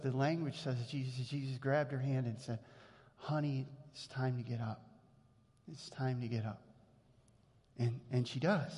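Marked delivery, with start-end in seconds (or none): 3.2-6.6 soft and tender. Retold or imitated. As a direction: gently wake the little girl. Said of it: "he speaks softly to her".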